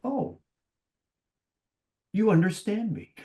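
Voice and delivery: light up as if finally understood; retold surprise. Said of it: "all of a sudden they go like".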